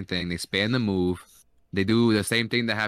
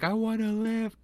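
retold crying plea; 13 semitones higher than usual. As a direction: imitate a tearful plea, pitched way up. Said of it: "she's fucking crying and she's like".

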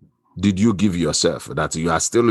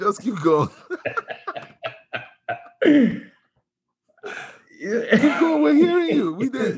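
laughing. Real, not acted.

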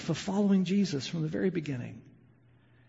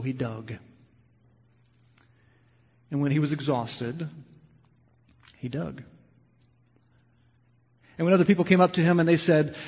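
story narration; 3 semitones lower than usual. Acted. Soft storytelling in sermon.

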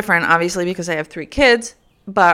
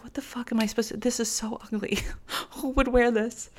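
mock whisper, appalled and very soft; hushed, higher-pitched griping; whispered disgust; 5 semitones higher, 11 dB quieter than usual. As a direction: imitate an aunt hissing complaints behind a hand. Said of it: "She whispers to".

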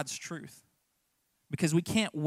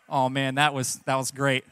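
ordinary talk

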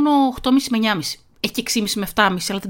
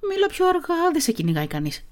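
high-pitched imitation of a drama teacher; Νάντια; 7 semitones higher than usual. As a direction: mimic the teacher's reminder, pitched well up. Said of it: "Η Νάντια είναι δασκάλα που κάνω θέατρο". (μίμηση δασκάλας με ψιλή φωνή)

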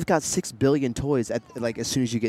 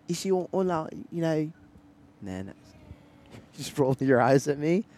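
put-on Australian accent; 5 semitones higher than usual. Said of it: "Mine just sounds like Crocodile Dundee".

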